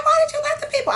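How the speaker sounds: reenacted scream at the seller; retold screaming. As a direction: scream the question down the phone. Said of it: "I was crying and screaming on the phone".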